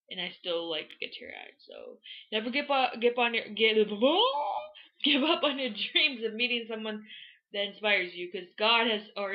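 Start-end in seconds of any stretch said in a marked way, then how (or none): 3.8-5.0 very high, drawn-out, loud "ooh"; flustered squeal. Real, not acted. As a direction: squeal over your own stumble, holding it high. Tongue-tied, gives up on the sentence.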